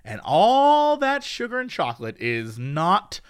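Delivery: low, slow spooky storyteller; spooky announcer. Acted. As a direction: creep it up like a campfire tale. Spooky ad read.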